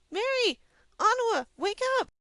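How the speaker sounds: high, loud urgent call; yelling up at her friends' open window. A narrator's reading. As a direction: shout them awake. Calling up to the window.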